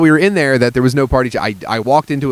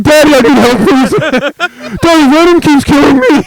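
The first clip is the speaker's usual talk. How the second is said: put-on loud, high kid voice right on the mic; too close to the mic; 14 semitones higher, 7 dB louder than usual. Boy with mic in his mouth begging dad, loud and high. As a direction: shout a kid's plea into the mic. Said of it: "talking like this".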